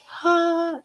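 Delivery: high, breathy intake of awe; awed gasp. Retold or imitated. Speaker recalls seeing the handsome doctor walk out, awed gasp. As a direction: imitate a smitten gasp.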